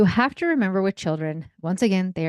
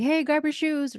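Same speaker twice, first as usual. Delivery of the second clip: high-pitched call without anger; calling across room; 8 semitones higher than usual. Acted. Parent ordering kids from across the room, high mild call. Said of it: "yell across the room, not even, like, angry shouting".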